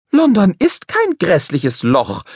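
put-on high, slow, indignant girl's voice; indignant protest. A narrator's reading. Polly protests indignantly to the upset boy. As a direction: defend London, offended. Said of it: "protestierte Polly empört".

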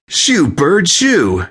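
high-pitched frantic yell. A narrator's reading. Guest chasing a pigeon off the cake, yelling. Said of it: "waving his hands around and yelling".

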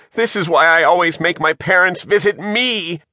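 high-pitched panicky complaint; frightened wail. A narrator's reading. Shivers dangles from the anchor chain, wailing. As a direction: wail it while hanging on tight.

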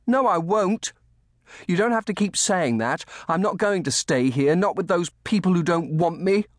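put-on high, loud boy refusing; defiant. A narrator's reading. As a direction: dig your heels in like an upset child. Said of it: "flung back his head defiantly".

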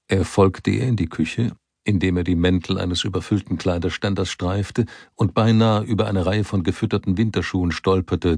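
usual speaking voice

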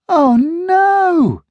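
high, loud cry of dismay; girl crying out as her dogs fall over. Acted. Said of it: "Jackie cried".

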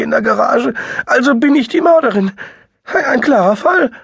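very high, loud and crying; rising into tears; tearful high voice. A narrator's reading. Sister spirals in despair.

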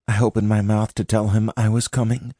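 plain reading voice

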